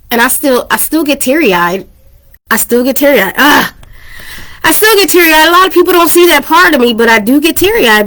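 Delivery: fighting back tears while talking about the diagnosis; teary-eyed. Real, not acted. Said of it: "I don't know why I'm emotional today".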